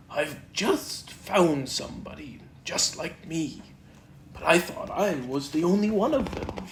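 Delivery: lightly voiced bouncy Tigger; a hint of excited Tigger at the mirror.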